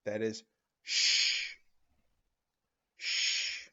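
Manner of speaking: a slow, drawn-out unvoiced sh; sh sound. Shown as a demonstration. Teacher showing children the new sh sound. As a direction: push the air out in a long sh. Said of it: "you get a new sound".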